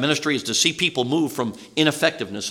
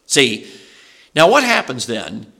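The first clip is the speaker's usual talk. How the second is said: ordinary talk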